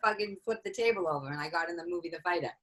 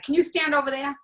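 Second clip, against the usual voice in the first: higher, louder, mock-alarmed request; retold casting director, pitched up; wary casting director; 8 semitones higher, 6 dB louder than usual. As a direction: imitate someone keeping you at a distance. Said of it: "like I had a disease".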